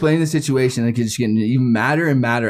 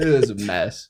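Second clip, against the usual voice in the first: ordinary talk, nothing marked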